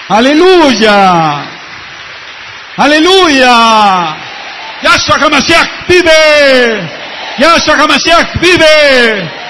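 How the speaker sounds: loud, drawn-out shouted praise, high and ringing; preaching at full force. Real, not acted. Shaliach exhorting the congregation, full-force preaching shout. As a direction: shout the praise to the back of the hall. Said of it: "habla fuerte".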